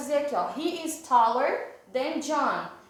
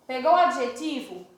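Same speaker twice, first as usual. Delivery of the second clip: ordinary talk, nothing marked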